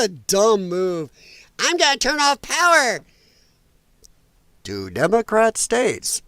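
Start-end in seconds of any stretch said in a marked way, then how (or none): 1.6-6.2 high, loud mock announcement; mocking threat. Streamer mocking a politician's threat to cut power, high and loud. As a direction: put on a big, blustering mock threat. Mocks the threat he calls dumb.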